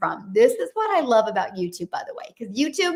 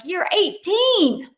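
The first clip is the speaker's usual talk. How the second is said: slow, high, louder disbelief; incredulous; 7 semitones higher, 4 dB louder, much slower than usual. Retold or imitated. To her son's girlfriend, amazed she feels pressure at eighteen. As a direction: stretch it out in disbelief. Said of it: "And I'm like".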